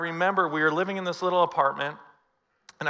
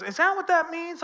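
puzzled wife; 13 semitones higher than usual. Retold or imitated. Wife voiced reading the bill.

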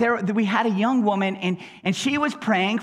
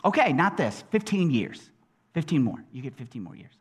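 low, soft, understated voice of God; quiet, low concession; God answering; 8 semitones lower, 4 dB quieter, faster than usual. Retold or imitated. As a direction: grant it casually, dropping away softly. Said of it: "And God said what?".

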